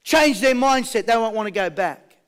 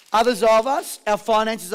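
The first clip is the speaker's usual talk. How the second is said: normal talking voice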